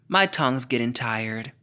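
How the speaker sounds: ordinary talk, nothing marked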